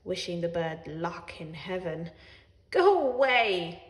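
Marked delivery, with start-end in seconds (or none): 2.7-3.8 hissed. A narrator's reading. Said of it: "hissed at me".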